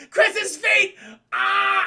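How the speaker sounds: shrieking high and loud at the feet; cat-crazed yell. Retold or imitated. Boyfriend voicing the cat's frenzy, loud high shriek. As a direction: ham up a frenzied pounce cry. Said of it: "He goes".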